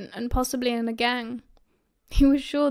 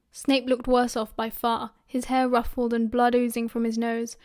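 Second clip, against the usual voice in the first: usual speaking voice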